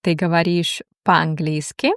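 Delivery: ordinary talk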